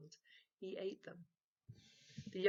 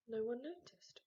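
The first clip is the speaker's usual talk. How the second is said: plain reading voice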